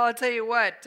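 ordinary talk, nothing marked

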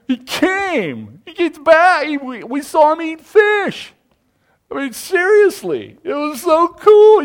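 high, loud, thrilled eyewitness report; breathless-with-news excitement. Acted. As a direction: act out gushing to a friend who missed it. Disciples excitedly tell absent Thomas.

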